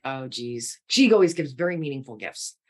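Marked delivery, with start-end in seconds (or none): 0.1-0.8 low, soft oh geez; hushed dread over a gift; quiet sigh of dread. Retold or imitated. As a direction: mutter it, bracing yourself. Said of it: "I was like".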